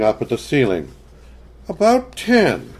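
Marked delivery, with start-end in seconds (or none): 1.7-2.7 put-on high little girl voice; young girl voice. A narrator's reading. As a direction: answer like a careful child. Child answering mother.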